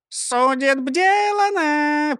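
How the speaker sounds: put-on high, slow babyish chirp; chirping cartoon princess. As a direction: chirp it like a tiny girl mangling words. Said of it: "прощебетала Клара". (щебечущая мультяшная принцесса)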